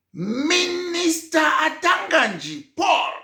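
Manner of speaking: slow, high, loud call-out of the name; indignant outburst. Real, not acted. Speaker denounces the minister indignantly.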